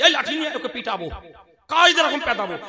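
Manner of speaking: hammed-up threat to beat the pupil; mock angry threat. Teacher threatens beating. (হাসিমাখা নাটুকে হুমকি)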